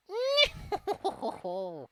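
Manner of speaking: high cackling laugh; witch cackle. Acted. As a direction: cackle like a wicked old hag.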